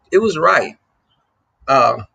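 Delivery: ordinary talk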